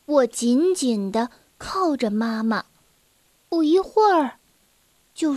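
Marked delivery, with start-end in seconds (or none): none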